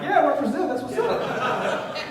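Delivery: high, loud, laughing "represent" slowing at the end; proud and amused; laughing boast. Retold or imitated. His proud laughing reply.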